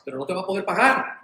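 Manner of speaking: ordinary talk, nothing marked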